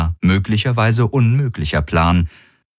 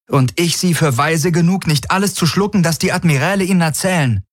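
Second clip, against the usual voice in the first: higher voiced young woman, defiant and quick; defiant Kirai; 10 semitones higher than usual. A narrator's reading. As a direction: throw the doubt back at him, higher and faster. Kirai defiant in narrated dialogue.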